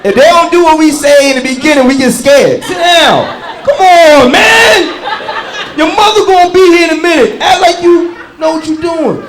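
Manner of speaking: put-on loud, high panicked pleading with a child; frantic dad yelling. Scared father yelling at his kid to behave. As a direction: shout like a dad losing control.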